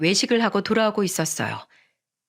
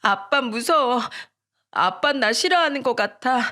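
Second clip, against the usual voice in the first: put-on higher voice of a frightened little boy; tearful child; 4 semitones higher than usual. A narrator's reading. Scared son confiding in mom about dad, tearful child voice. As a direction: play an upset son, lightly tearful. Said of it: "그제야 소리내서 울기 시작했습니다".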